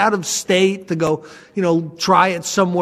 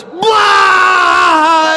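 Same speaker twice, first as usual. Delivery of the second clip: high, loud burst of laughing blah; lawyer cracking up; 11 semitones higher, 6 dB louder than usual. Retold or imitated. Lawyer finally losing it over the tape, loud burst. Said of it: "right, goes".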